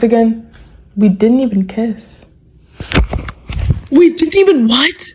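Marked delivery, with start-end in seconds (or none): 3.9-5.0 high, incredulous echo rising on "what"; shocked disbelief. Acted. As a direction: repeat it in outraged disbelief, pitched up. Shocked reaction to the message.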